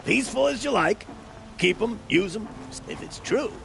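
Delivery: high, excited rumour-telling; eager sales pitch. Acted. Schemer selling a Banuk rumor to huntress, eagerly.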